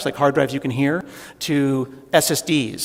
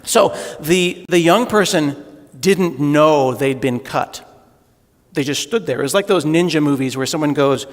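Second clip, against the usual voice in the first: usual speaking voice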